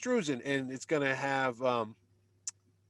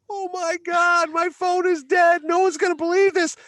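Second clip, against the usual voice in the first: panicked fanboy; 13 semitones higher, 7 dB louder than usual. Retold or imitated. Fan with a dead phone finds the Avengers, high panicked squeal. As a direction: re-enact a starstruck meltdown at full pitch.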